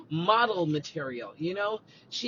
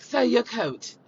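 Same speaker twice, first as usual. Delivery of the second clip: formal butler voice, a touch louder; mock butler; 5 semitones higher, 6 dB louder, slower than usual. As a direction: serve him like a butler. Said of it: "I talk like I'm a butler".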